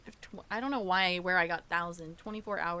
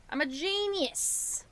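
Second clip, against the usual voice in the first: higher, slow and self-satisfied; high proud voice; 8 semitones higher, 3 dB louder, slower than usual. Shown as a demonstration. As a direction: crow it up high. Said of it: "repeats it in a higher voice".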